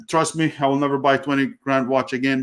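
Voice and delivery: ordinary talk, nothing marked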